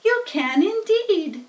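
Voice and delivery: high, slow little voice; spider voices. A narrator's reading. Spiders answer Wilbur, high and slow. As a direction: answer kindly and slowly. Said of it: "said the spiders".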